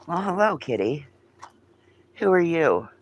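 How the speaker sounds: high, sing-song voice for a cat; high pet voice greeting a stray cat; cat-talk voice. Real, not acted. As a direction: coo up high at the kitty. Greeting a cat under the Jeep.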